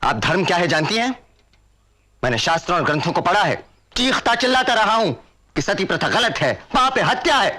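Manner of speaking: high, impassioned protest; outraged. Acted. Son challenging his mother over sati, outraged. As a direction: argue back in heated indignation.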